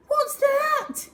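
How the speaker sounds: very high frightened cry; girl terrified of an approaching shape; scared scream. A narrator's reading. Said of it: "she screamed".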